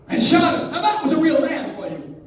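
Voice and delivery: loud, high burst with a laugh; big, high, amused mock admiration; mock bravado. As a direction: exclaim it with gusto, half laughing. Lecturer relishes the awkward dinner remark.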